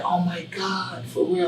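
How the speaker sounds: soft slow gasp of disbelief; stunned and hushed, can't believe it; amazed disbelief. Retold or imitated. Cashier hears he will pay, hushed amazed disbelief. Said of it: "She was like".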